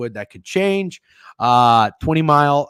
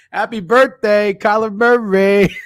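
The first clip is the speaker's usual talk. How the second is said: mock greeting; 7 semitones higher, 4 dB louder, slower than usual. Real, not acted. Gloating mock birthday wish.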